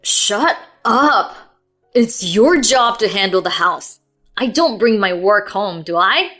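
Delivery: loud, angry tirade; venting fury. A narrator's reading. Husband venting at wife about her complaints, loud tirade. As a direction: unload all of it on her. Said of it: "He was venting pent-up frustrations".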